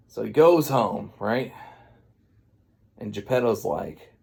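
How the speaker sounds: ordinary talk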